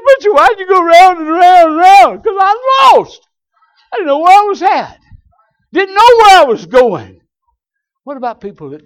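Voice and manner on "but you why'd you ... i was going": high, loud preacher's cry; preaching shout. Acted. Preacher telling church why he went round and round, shouting. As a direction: cry it out to the crowd. Preaching climax on being lost.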